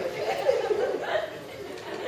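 high airy laugh; helpless laughter. Real, not acted. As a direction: burst out laughing. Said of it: "I'm like".

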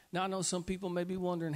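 ordinary talk

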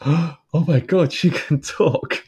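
high, slow astonished dog voice; mock dog amazement. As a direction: gasp it out high like a dazzled dog. Voicing the dog's amazement.

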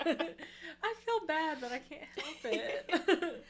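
slow words through laughter; giggling apology; laughing. Real, not acted.

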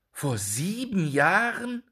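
high, slow cry of horror; horrified cry. A narrator's reading. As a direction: repeat it in disbelief and shock. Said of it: "rief Jakob mit Entsetzen". (entsetzter Ausruf)

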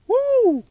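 high, loud exultant whoop; joyful woo. Real, not acted. Preacher rejoicing over the promise of glory, loud whoop. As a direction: shout for joy at the verse.

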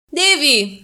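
loud, high, merry shout of a name; loud cheerful call across the room. A narrator's reading. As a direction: call him over happily. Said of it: "kiáltott Marcia vidáman".

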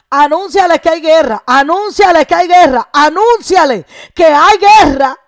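building preacher's call; fiery preaching. Real, not acted. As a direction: drive the repetition like a battle cry. Preaching at full force.

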